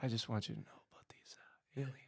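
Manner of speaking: breathy seductive whisper; chat line whisper. Retold or imitated. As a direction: whisper it like a late-night hotline. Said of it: "That's the chat line voice right there".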